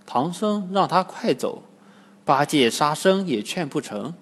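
usual speaking voice

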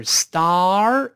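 star先张大嘴发“啊”，再勾舌尖，拖长; 慢读star，“啊”后卷舌; ar勾舌. Shown as a demonstration. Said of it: "再勾舌尖的二". (star拉下颏再卷舌)

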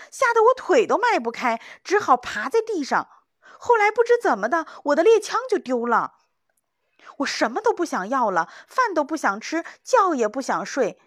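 high, loud, fearful pleading; frightened rush. Acted. Young hunter telling the old hunter his nightmare, high and fearful. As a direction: pour out the terror.

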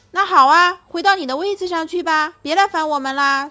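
loud, high, quick teasing; old bear shoos the lion away, loud teasing. Acted. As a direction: shoo the lion into his cage.

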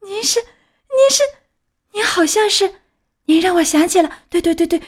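put-on high, halting, tearful child voice; stammering. A narrator's reading. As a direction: stumble over repeats as recognition dawns. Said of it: "匹诺乔结结巴巴地回答".

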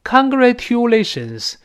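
"congratulations" with a t-yoo in the middle; "congratulations" pronounced con-gra-TYOO-lations; wrong tu pronunciation. Shown as a demonstration. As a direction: say "congratulations" with unblended "tu", not "chu". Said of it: "不要把它读成".

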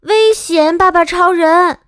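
high, loud warning call; child loudly warning dad of danger; loud shout. Acted. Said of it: "我大声呼喊".

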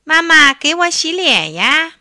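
put-on high, loud childlike whine; whiny kitten. A narrator's reading. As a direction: whine for mother's help like a pampered little one. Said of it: "小猫咪哼哼唧唧地说".

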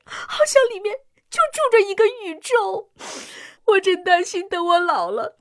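weeping through every word, fighting to keep talking; tearful crying. Acted. Mother to the doctor, fearing for her daughter's future, crying.

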